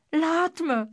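high, loud desperate plea; urgent high-pitched call; pleading cry. A narrator's reading. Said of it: "wilde Elvis roepen".

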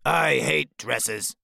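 slow, grumpy grumbling voice; grumpy mutter. Acted. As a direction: grumble it like a grouch. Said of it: "Grouchy mutters".

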